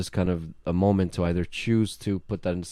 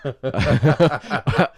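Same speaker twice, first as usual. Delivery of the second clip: laughing; 6 semitones higher, 6 dB louder than usual. Real, not acted. Laughing while telling hypotenuse joke.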